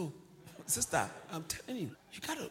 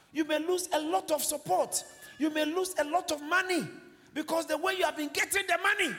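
high, loud, driving sermon; forceful preaching; 8 semitones higher, 6 dB louder than usual. Real, not acted. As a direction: hammer each point to the congregation.

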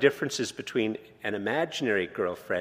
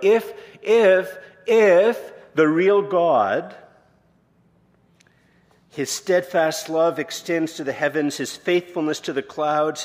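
higher, louder, slow fervent preaching; preaching at full force; 6 semitones higher, 7 dB louder, slower than usual. Acted. Preacher to congregation on God's steadfast love, fervent full-force preaching. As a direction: build to a soaring climax about God.

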